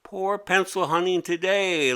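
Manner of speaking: a pest mocking the girl; creature calling out. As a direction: call across the room, gloating. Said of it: "it called out".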